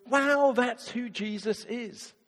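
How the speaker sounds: slow, high, amazed realisation; a drawn-out wow of discovery. Pretend. Congregation member realising, slow amazed wow.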